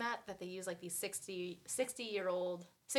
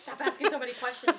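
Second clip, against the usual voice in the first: mock scolding; 6 semitones higher, 9 dB louder than usual. Voicing Jason telling her off.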